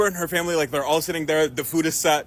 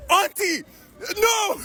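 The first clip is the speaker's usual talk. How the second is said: desperate protest; 12 semitones higher, much slower than usual. Retold or imitated. Nephew protesting as auntie refuses to help, high yelp. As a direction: cry out as she betrays you.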